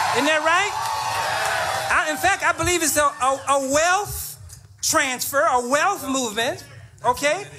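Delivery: rousing call-and-response pulpit delivery; rally preaching. Real, not acted. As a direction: preach it to a cheering crowd. Rallies crowd like preacher.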